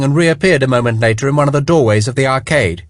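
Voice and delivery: plain reading voice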